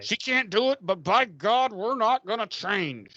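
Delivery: high-pitched, hammed-up defiance; mock stubborn insistence. Producers refusing to drop the accent, mock stubborn insistence. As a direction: dig in as an obstinate producer.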